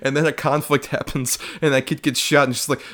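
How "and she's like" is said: ordinary talk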